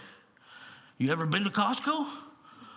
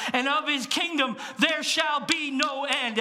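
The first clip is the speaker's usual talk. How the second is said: proclaiming shout; 5 semitones higher, slower than usual. Real, not acted. Preacher reading Luke to congregation, slow ringing shout. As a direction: build the last line of scripture to a ringing climax.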